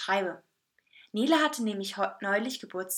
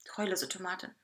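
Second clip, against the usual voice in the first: plain reading voice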